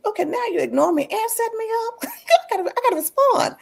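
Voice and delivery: very high-pitched playful indignation; mock ticked off. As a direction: replay being miffed in a squeaky-high voice. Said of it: "I'm kinda like, tick".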